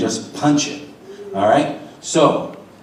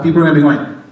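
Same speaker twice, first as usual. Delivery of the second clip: normal talking voice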